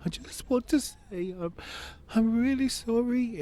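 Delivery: high, tearful re-enacted apology; imitated wailing. Father retelling his apology to his ex-wife, high mock wail. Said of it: "I started wailing".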